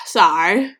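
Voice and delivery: swallowing tears; a wobbly, emotional sorry; choked sorry. Real, not acted. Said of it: "just getting emotional talking about it".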